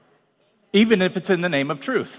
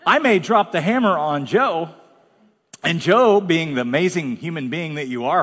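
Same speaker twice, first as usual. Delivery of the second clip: ordinary talk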